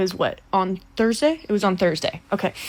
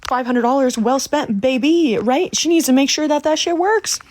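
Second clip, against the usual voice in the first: higher, louder crowing; triumphant boast; 5 semitones higher, 5 dB louder than usual. Real, not acted. Trickster boasting that the client's money was well spent, triumphant. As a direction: crow it, pitch climbing to the end. Boasts about earning her fee.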